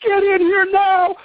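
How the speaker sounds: high, slow, drawn-out yell of an angry judge; retold scream.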